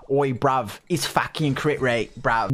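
low, slow mock British bloke; British accent. Someone passes on a crit rate rumor, low British accent. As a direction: put on a deep British tip-off. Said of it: "I heard someone tell me".

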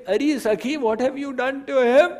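high, fast incredulous exclamation; amused gopi. Acted. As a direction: gasp at his dung-covered face. Second gopi laughing at Krishna.

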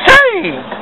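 soaring high, drawn-out preacher cry on saved; hammed-up televangelist wail; television preacher. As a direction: belt saved way up like a TV evangelist. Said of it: "Almost sound like a television preacher there".

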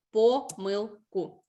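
slow, "помилку" broken into separate syllables. Real, not acted. Teacher explaining the correction to pupils, slowly syllable by syllable. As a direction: space out each syllable of "помилку".

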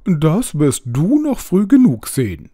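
high, loud, demanding bird voice; a panicky owl ordering its rescuer around; bossy owl. A narrator's reading. Wet owl bossing the narrator to carry it to a tree, high and urgent.

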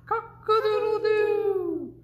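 high, loud, slow rooster crow. Pretend. Parent reading aloud, a high crowing rooster. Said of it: "He can sound like a rooster".

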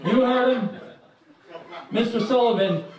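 usual speaking voice

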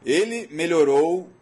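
ordinary delivery

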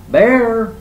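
high, loud call for help; urgent, high-pitched shout. A narrator's reading. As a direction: holler for a friend up the hill. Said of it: "shouted Rabbit".

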